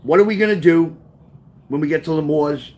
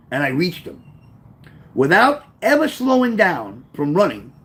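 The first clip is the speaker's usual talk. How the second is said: plain reading voice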